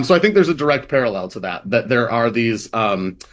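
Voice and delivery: normal talking voice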